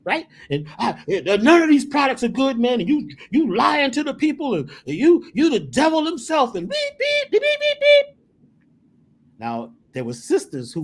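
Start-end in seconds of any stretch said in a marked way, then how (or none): 0.8-6.5 mock angry heckler. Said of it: "he's angry". 6.7-8.2 high squeaky bleeps standing in for swearing; bleeped curse words. Retold or imitated. Censoring the angry heckler's curses with high beeps. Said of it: "Every curse word".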